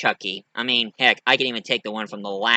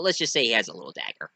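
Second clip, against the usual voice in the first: ordinary delivery, nothing marked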